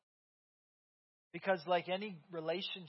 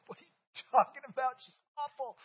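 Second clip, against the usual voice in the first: son's teasing retort; 6 semitones higher, 4 dB louder than usual. Retold or imitated. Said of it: "Camden's like".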